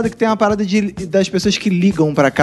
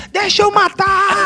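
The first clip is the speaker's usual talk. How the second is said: much higher, slow mock shriek; mock scream; 11 semitones higher, slower than usual. Joking about the neighbor screaming at night, much higher. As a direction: howl it high as a joke on the neighbor.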